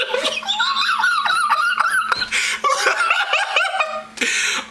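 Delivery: long high-pitched squealing laugh; shrieking with laughter, unable to go on; helpless laughing fit. Real, not acted. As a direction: burst into uncontrollable laughter. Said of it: "I don't know why I'm laughing".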